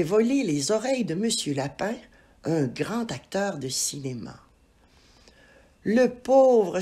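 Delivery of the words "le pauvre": exclamation. Acted. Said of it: "s'exclama le serpent".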